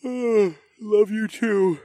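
slow, drowsy words through a yawn. A narrator's reading. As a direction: say it mid-yawn. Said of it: "I replied with a yawn".

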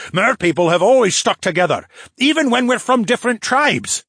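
demanding. A narrator's reading. Phineas rebukes one of his people, demanding. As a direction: protest forcefully, pitched well up. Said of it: "Phineas demanded".

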